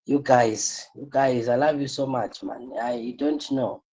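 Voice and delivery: usual speaking voice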